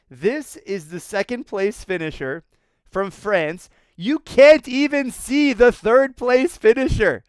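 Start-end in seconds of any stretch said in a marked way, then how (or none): 3.9-7.2 loud, high, slow marvelling; amazed emphasis. Real, not acted. To viewers, marvelling that third place is out of sight, slow and loud. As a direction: stretch it out in awe.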